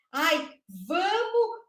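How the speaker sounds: high singsong mock suggestion; imitating teachers' advice. Teacher parodying colleagues' painting tip to children, high imitating voice. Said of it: "A gente não fala isso?".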